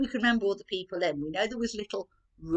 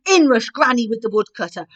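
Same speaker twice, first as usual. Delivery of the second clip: usual speaking voice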